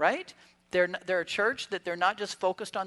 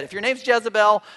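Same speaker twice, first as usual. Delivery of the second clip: retold joking call; 3 semitones higher, 10 dB louder than usual. Retelling his joke from the sermon.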